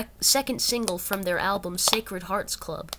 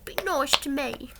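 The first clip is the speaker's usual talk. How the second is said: very high, pleading mock Cockney; silly little Cockney voice, way up high; bashful Cockney impression; 7 semitones higher than usual. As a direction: plead shyly in a badly faked Cockney. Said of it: "Cockney accent without something, sounding stupid".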